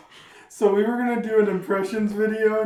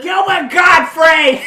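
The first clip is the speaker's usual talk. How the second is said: high, drawn-out yell; just yelling; 9 semitones higher, 13 dB louder, slower than usual. Acted. Friend overdoing an impression, just yelling. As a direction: bellow the name slowly, high and loud. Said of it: "Now you're just yelling".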